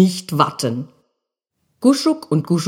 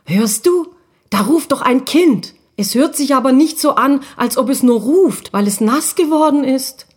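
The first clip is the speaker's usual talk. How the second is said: worried sister ghost; 6 semitones higher than usual. Acted. Guschugina voiced by narrator.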